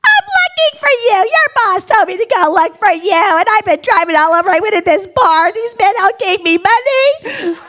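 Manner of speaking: high, hammed-up tearful wail; comic high-pitched crying rant; bawling. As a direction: blurt it out while mock-bawling. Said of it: "sitting there bawling my eyes out".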